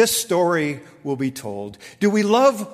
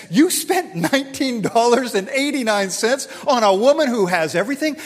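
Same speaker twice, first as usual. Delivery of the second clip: higher and louder, pressing the point; the husband can't believe the price; outraged over a nineteen-dollar doll; exasperated disbelief; 5 semitones higher, 4 dB louder than usual. Retold or imitated. Arguing with wife about doll.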